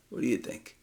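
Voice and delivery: whispered joke. Real, not acted. Joking lion aside.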